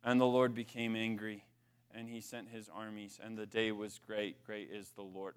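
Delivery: low, hushed, overly grave scripture voice; mock pious reading voice, dropping low and quiet; solemn Bible reading. Shown as a demonstration. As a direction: read it like a stuffy Bible study, low and soft. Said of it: "where people read out loud".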